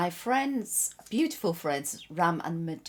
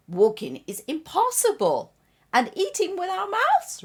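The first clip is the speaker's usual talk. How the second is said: loud storybook disbelief, climbing high; incredulous scoffing; 13 semitones higher, 8 dB louder than usual. Acted. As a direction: scoff louder and higher, ending on an incredulous question. Acting scoffing baby in parable.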